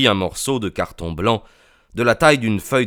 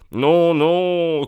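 «Non, non» lancé beaucoup plus haut; crier; 8 semitones higher than usual. A narrator's reading. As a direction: retenir le client d'un cri. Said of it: "cria Holmes". (Holmes retient le client en criant haut)

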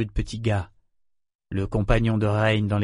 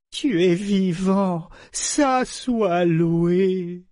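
high, slow, drawn-out thanksgiving; overcome with relief; 8 semitones higher, much slower than usual. A narrator's reading. Mother to her living son, overcome with relief. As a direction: stretch the words out in heartfelt relief.

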